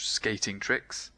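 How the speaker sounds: plain reading voice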